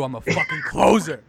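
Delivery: mock tough boss. Quoting the Baldwin speech.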